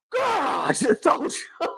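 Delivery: high, strained mock scream without volume; slow, stretched imitation of silent screaming; mock inner scream. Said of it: "he was screaming".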